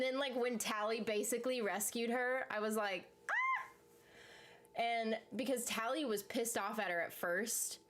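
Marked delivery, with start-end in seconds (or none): 3.3-4.8 very high delighted squeal; excited squeal. Retold or imitated. Fan watching Tally rescue Alder, squealing with delight.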